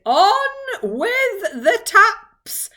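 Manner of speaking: long, high bellowed command; shout. A narrator's reading. Voice on top of the cloud repeats its order, shouting. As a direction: call it out very slowly and loudly. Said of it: "it shouted".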